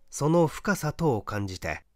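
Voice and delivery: ordinary delivery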